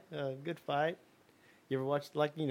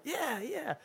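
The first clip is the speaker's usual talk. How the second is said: eager yes; 12 semitones higher, 5 dB quieter than usual. Retold or imitated. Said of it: "He's like".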